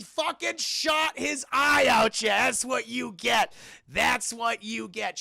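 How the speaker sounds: loud, drawn-out crowing shout; worked-up shout. Real, not acted. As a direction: holler each word out slowly. Worked-up shouting over the accident.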